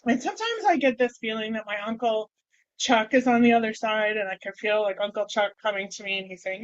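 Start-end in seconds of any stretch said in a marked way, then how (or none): none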